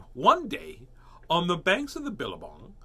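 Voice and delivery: put-on lively, lilting read to children; high, swooping storyteller pitch; storybook reading. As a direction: open the tale with relish. Reads picture book aloud.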